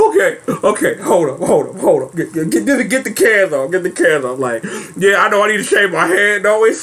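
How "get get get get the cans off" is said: ordinary talk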